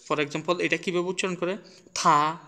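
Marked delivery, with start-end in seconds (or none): 2.0-2.4 "থা" with a loud puff of air; aspirated t. Shown as a demonstration. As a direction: release a burst of breath on "থা". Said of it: "বাতাস বেরোবে".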